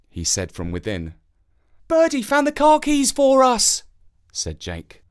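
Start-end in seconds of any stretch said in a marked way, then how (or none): 1.9-3.8 put-on high, loud surprised child; a boy at the window seeing the crow with keys, high and excited. A narrator's reading. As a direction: gasp it out in delight. Jake excited.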